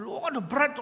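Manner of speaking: high, loud, hysterical cry; retold hysterical outburst, high and loud; hysterical yell. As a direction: shriek it like a father losing it over his car. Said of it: "udah teriak".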